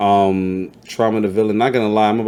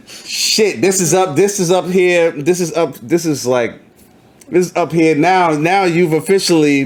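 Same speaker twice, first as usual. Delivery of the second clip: excited; 8 semitones higher than usual. Real, not acted. Hyped reaction to a big interview.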